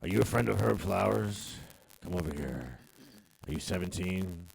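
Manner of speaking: low, soft sleazy cop voice; creepy voice. Pretend. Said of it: "the voice the cops use".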